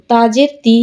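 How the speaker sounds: no stretch on তি; short vowel. Shown as a demonstration. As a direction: end on a short তি. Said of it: "এখানে আবার হরকত".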